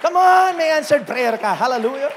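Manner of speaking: fast, high and loud calls of praise; a preacher shouting out jubilant praise; excited praise. Real, not acted. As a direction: keep the church fired up. Pastor calls out after the shout.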